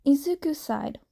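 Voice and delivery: ordinary delivery, nothing marked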